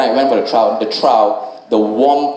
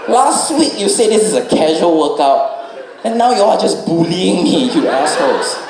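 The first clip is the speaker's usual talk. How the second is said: higher, a touch indignant; tired angry retort; 5 semitones higher than usual. Retold or imitated. Comic talking back to the coach. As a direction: snap back half-heartedly. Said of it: "I was like".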